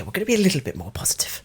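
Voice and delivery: quick, soft mocking repeat; mocking echo. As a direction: parrot it back teasingly.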